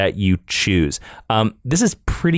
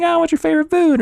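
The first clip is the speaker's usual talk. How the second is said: mock chirpy question; faster than usual. Mimicking simple email prompts.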